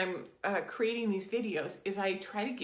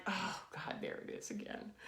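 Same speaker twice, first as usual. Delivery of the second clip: weary groan; 9 dB quieter than usual. Retold or imitated. Inner voice noticing a trigger, weary groan. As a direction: drop to a tired, breathy groan under the breath.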